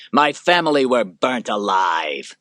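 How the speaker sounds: loud, slow hurled words; angry outburst. Acted. Maximus to Lucilla, loud and slow in anger. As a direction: throw the words at her. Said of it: "throwing the words at her in anger".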